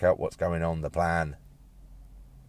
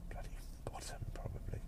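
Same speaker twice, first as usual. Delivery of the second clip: whispered aside; 14 dB quieter, faster than usual. Acted. Ex's rival, muttered aside.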